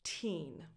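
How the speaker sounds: put-on teen soft and falling with a long ee; teen ending. Shown as a demonstration. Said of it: "numbers that end with".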